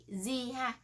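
ordinary talk, nothing marked

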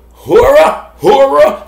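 "Hora" said HOR-uh, with an OR vowel; variant pronunciation. Shown as a demonstration. Host trying names for the garden knife, "Hora" as HOR-uh. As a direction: punch out "Hora" as HOR-uh, loud and high. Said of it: "whatever you wanna call it".

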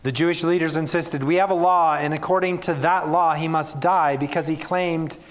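measured Bible reading to the congregation; earnest public reading of scripture. Acted. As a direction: read the passage with weight and care. Preacher reads scripture passage aloud.

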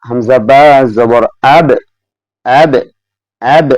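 slow, bouncing echo on the final ব of আব; slow Quranic recitation demo of আব; qalqalah demo. Shown as a demonstration. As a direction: let আব end with a sharp rebound on ব. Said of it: "কিভাবে পড়বেন?".

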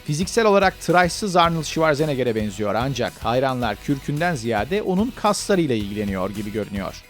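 ordinary talk

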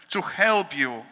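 ordinary talk, nothing marked

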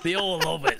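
real laugh breaking through the words. Real, not acted. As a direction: say it while cracking up. Cracks up at own strategy name.

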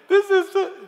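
very high, loud, strangled with laughter; realizing mom will find it, high panicked squeak. Retold or imitated. Panic sitting next to Melissa.

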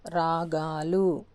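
very slow, stretched రాగాలు; long vowel. Shown as a demonstration. Teacher modelling the plural రాగాలు for learners, long vowels drawn out. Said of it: "బహువచనంలో రాగాలు".

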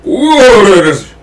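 high loud whoa; playful high call. Pretend. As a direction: call out like taming a horse. Echoing call higher.

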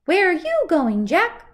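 high loud crowing rooster. Acted. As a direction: crow it out like a rooster. Rooster character speaking.

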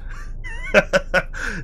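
high burst of real laughter; big laugh. Real, not acted. Laughing at own joke.

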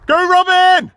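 high, loud cheer from the sidelines; cheering a racer going past; cheering shout. Real, not acted. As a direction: yell it out to the runner. Cheering runner at race.

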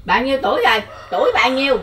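loud, high question to a deaf ear; to someone hard of hearing. Real, not acted. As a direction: call it out loud so she hears. Shouting question to deaf woman.

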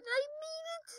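very high squeal of success; triumphant squeal. Real, not acted. Player clearing the last obstacle, triumphant squeal.

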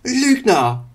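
high, loud angry shout; furious shout. Acted. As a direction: scream it at him. Wandel shouts at Illidan. (wütender Schrei)

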